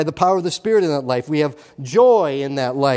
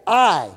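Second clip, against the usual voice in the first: "I" punched out alone, strongly stressed; emphatic I; 3 semitones higher, 4 dB louder than usual. Shown as a demonstration. Preacher shows who is speaking, emphatic "I". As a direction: land hard on a single "I". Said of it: "that's emphatic".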